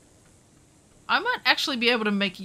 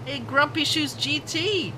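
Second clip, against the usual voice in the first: ordinary talk, nothing marked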